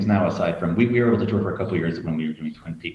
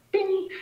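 mock bing. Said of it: "And I was like".

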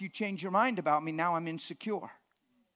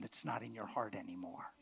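whisper; 8 semitones lower, 12 dB quieter than usual. Real, not acted. Trailing sermon line, whispered.

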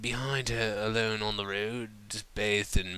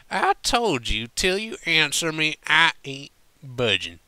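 a higher, louder southern twang, dug in; tipsy cowpony refusing to budge; stubborn drunk; 7 semitones higher, 7 dB louder than usual. Acted. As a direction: plant your hooves and refuse. Drunk Applejack refuses to move.

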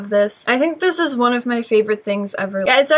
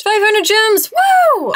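much higher and louder cheer; excited cheer; 10 semitones higher, 4 dB louder, slower than usual. Real, not acted. Vlogger thrilled at winning gems, high cheer. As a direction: squeal it out like a big win.

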